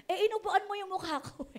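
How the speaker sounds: a hint of a cheeky child; child's retort. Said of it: "sabi ngayon ng bata".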